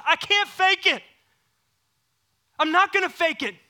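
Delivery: loud, high refusal; defiant outcry. Retold or imitated. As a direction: cry it out, refusing to pretend. Hannah voiced, defiant outburst.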